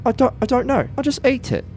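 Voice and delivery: flustered panic. Retold or imitated. Flustered under questioning.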